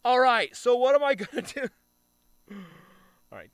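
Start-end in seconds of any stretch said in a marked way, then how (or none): 0.0-1.7 high, loud mock enthusiasm; mock eager. Gamer mocking himself opening his phone, high loud eager. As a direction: ham up the eager start of the day. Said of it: "I open my phone and I'm just like".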